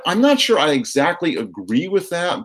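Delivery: ordinary talk, nothing marked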